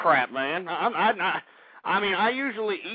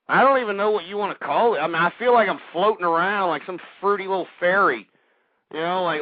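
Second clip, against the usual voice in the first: ordinary talk, nothing marked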